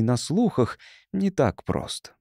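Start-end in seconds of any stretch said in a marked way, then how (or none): none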